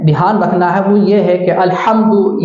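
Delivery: ordinary talk, nothing marked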